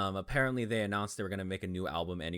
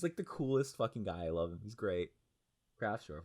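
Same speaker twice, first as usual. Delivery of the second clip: normal talking voice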